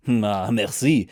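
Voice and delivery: put-on slow, easy French accent on merci; a soldier thanking in a French accent. A narrator's reading. A German soldier greets the Frenchman, slow French accent. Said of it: "added with a good accent".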